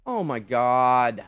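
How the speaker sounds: slow, loud drawn-out oh my god; exasperated groan. Real, not acted. Gamer groans over the drowned dino, exasperated. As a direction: stretch it out in disbelief.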